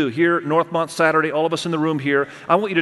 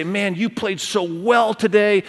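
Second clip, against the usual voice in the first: encouraging parent; 5 semitones higher, slower than usual. Retold or imitated. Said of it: "just say".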